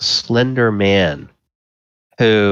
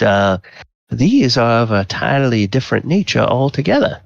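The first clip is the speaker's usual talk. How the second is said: chatty gallant with swooping lilt; lightly voiced flirtatious gentleman at a ball; Volo; 3 semitones higher than usual. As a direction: play a curious, wordy admirer. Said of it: "you realize from the voice".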